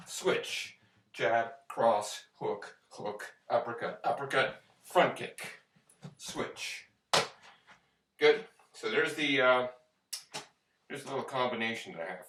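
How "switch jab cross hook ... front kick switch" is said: boxing coach calling combos. Shown as a demonstration. Calls combo while performing it.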